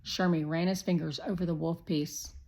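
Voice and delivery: ordinary delivery, nothing marked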